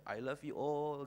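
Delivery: slow sing-song chapel greeting; affectionate greeting. Retold or imitated. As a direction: draw out each word fondly. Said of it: "saya selalu bilang begini".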